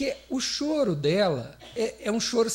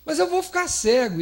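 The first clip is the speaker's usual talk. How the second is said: mock alarm; 9 semitones higher, 5 dB louder than usual. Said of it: "O dia que ele reclamou do olho".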